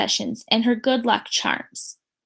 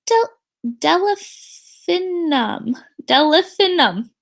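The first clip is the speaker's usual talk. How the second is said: very slow, savoured "Delphinum"; drawn-out name; 5 semitones higher, 4 dB louder, much slower than usual. Acted. Teacher introducing her own name, slowly. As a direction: stretch out the name lovingly.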